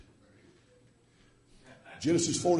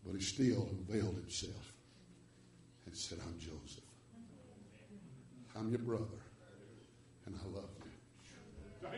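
much softer and lower, fading to a whisper; tender compassion; 6 semitones lower, 14 dB quieter than usual. Retold or imitated. Joseph unveiling himself to his brothers, tender and hushed. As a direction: gently reveal yourself with love.

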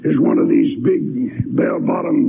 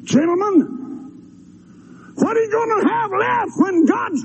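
high, impassioned shouted plea to the congregation; preaching at full force. Acted. Preacher pleading with ladies and gentlemen, shouting high. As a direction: cry out to the crowd, begging them to come.

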